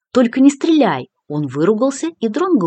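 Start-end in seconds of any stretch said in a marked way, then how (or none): 0.1-1.1 man down shout. A narrator's reading. Wounded man begging not to shoot.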